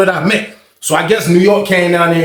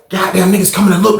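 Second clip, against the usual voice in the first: normal talking voice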